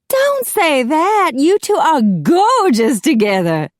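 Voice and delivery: high, loud, drawn-out wail; dramatic protest. A narrator's reading. Macy begs two guests not to leave, high dramatic protest. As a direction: plead with gushing theatrical dismay.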